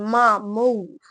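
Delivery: loud, exasperated burst at mom; held-back scream. Acted. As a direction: push it out loud, just short of screaming. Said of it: "trying not to scream".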